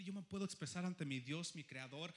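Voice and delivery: normal talking voice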